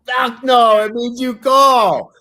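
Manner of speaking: high, loud and forceful; emphatic shout. Real, not acted. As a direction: hammer the point home. Emphatic answer to his question.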